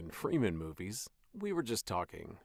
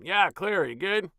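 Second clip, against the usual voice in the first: ordinary talk, nothing marked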